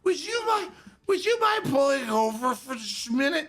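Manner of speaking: high, prim and overly polite ask; a mock-genteel plea to pull over. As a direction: play the drunk trying to sound extra courteous. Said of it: "I very politely said".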